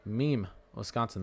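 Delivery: "Meme" said with a laugh, then slowly repeated; amused at a name. Real, not acted. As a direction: chuckle through "meme" as the name strikes him funny. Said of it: "that's funny".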